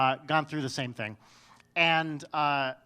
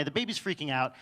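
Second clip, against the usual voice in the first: ordinary talk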